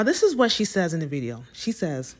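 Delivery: normal talking voice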